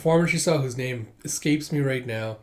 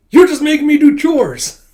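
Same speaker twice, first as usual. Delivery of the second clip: high, loud protesting yell; indignant outburst; 13 semitones higher, 13 dB louder than usual. Retold or imitated. Junior realises it is all chores, indignant shout. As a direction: explode in protest.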